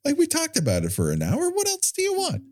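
squeaky exasperated complaint; high-pitched mock whine.

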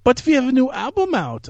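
high, protesting mock German accent; hammed-up German accent. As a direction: imitate a put-out German singer. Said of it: "my only German accent I can do".

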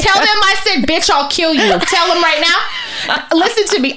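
high-pitched, heated retold rant without full volume; mock screaming, pushing each order; screaming demands. Neighbor orders a boy to translate, imitated screaming. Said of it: "I'm screaming in their face, telling them what to say".

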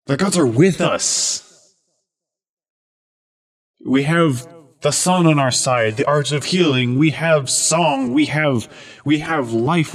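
loud, rallying battle speech; rally the crowd like a paladin; rousing speech. Acted.

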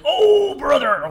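loud, high Hogan-style wrestler growl; wrestling promo. Retold or imitated. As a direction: bellow it like a wrestler on the mic. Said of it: "the wrestler voice".

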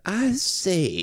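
high mock British toff; hammed-up rich British gent; pompous British accent. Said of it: "able to do that exact accent".

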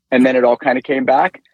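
ordinary talk

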